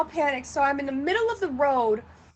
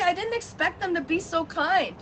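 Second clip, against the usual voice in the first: ordinary talk, nothing marked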